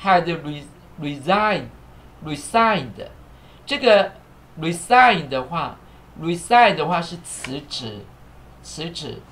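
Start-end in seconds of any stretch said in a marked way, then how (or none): 0.0-3.1 had resigned said slowly, word by word; slow classroom model of resigned; slow teaching demo. Shown as a demonstration. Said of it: "来，现在完成式".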